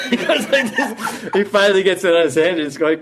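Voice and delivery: high, loud voice bubbling with laughter; cracking up mid-story; laughing while telling. Real, not acted. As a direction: tell it through real laughter. Breaks up telling the comic scene.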